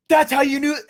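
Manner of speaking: high, loud exclamation; emphatic outburst. Retold or imitated. Boss protesting deleted episodes, loudly emphatic. Boss's retold outburst, false start.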